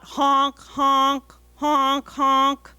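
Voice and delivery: loud goose honks; loud honking. Shown as a demonstration. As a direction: honk like a goose. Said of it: "I need to hear everybody honk".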